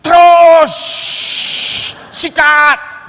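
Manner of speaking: very loud, high shout; yelling. Retold or imitated. Friend on the back yelling to speed on, loud shout. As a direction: scream at him to floor it. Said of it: "Lalu dia udah teriak".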